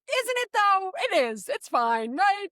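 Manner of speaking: high, mock-casual brush-off; mocking older self. Gen X host brushing off shocked young people, high and breezy. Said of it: "I'm like".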